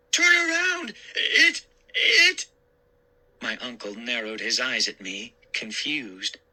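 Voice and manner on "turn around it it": high, loud, stammering terror; panicked scream. Acted. Boy screams at uncle to turn around, panicked. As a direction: choke on the words in fright. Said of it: "I finally managed to scream".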